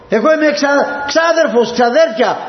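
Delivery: flustered cousin pleading to stay; indignant cousin. Acted. Cousin protests being thrown out.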